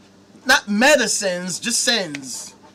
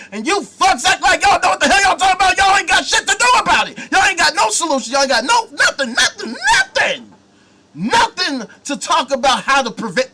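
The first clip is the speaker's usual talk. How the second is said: fast, loud, much higher angry yell; furious shout; 7 semitones higher, 6 dB louder than usual. Real, not acted. Ranting at church followers about doing nothing, furious shout. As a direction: let the rage boil over and shout them down.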